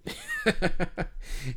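high-pitched laugh; a real laugh at the co-host's joke; amused laugh. Real, not acted.

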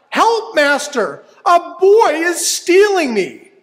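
high, loud panicked cry; crying out for help. Acted. Magic harp calls master as Jack steals it, high panicked cry. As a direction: call the master in alarm. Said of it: "harp cried out".

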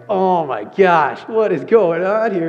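a retold gasp at the chaos; shocked. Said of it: "And I'm like".

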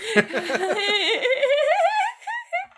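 high burst of real laughter; laughing. Real, not acted. Host amused by the actor's villain quote, laughing. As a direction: crack up into a long, high laugh.

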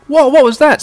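high, loud, alarmed cry; startled shout. Acted. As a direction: yelp in shock. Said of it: "says everyone".

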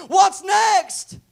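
high, loud demanding cry; urgent shout. Acted. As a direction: holler it up at God. Moses acted shouting to God.

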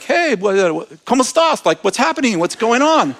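high-pitched, lightly imitated clamor; mildly mock commotion. Office workers coming out to see what's happening, imitated commotion. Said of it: "these guys are really loud".

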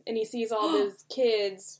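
ordinary delivery

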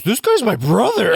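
Conan voice. Retold or imitated. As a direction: blurt out the instant conclusion. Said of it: "he instantly goes".

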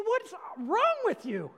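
loud, high, slow rebuke on wrong; exasperated shout at the listener; flipping out. Acted. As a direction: drag out What's wrong with you in a loud, high exasperated cry. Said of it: "sorry for flipping out on you".

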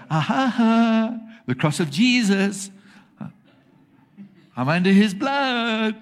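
high, loud, laughing taunt; gleeful mock worshipper. Believer waving the cross at angry God, gleeful laughing taunt. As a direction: crow it like you've outsmarted him.

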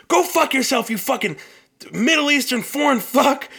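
imitating an angry customer yelling at an agent; mock furious yell. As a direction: hurl the insult in a hammed-up rage. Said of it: "You could say to them".

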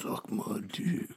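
slow, low whisper; hushed, teasing seductive demo; sexual undertone. Shown as a demonstration. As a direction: whisper it suggestively, drawn out. Shows sexual reading of the phrase.

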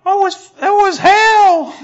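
a rock grumbling about years in a pocket; high cartoon rock whining loudly; moon rock complaining.

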